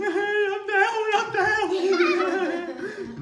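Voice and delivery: very high, loud and slow, half laughing; high squealing relief. Retold or imitated. After falling, squealing with relief at being down. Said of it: "I was like".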